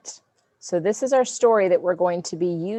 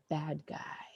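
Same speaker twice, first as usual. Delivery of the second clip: whisper; 12 dB quieter than usual. Real, not acted. Teacher whispers to class.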